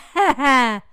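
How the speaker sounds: a burst of loud knowing laughter. Real, not acted. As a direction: laugh it off loudly. Laughing at the question.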